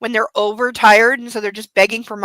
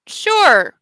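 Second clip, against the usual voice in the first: one short word, a little higher; uncharacteristically brief; 4 semitones higher, 4 dB louder than usual. A narrator's reading. Brother answers the best-man request with uncharacteristic brevity. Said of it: "he says with uncharacteristic brevity".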